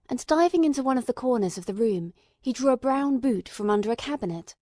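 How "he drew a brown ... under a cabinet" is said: ordinary delivery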